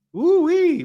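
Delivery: high, loud woo-wee; excited whoop. Real, not acted. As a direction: let out a happy holler. Exclaiming about the workout.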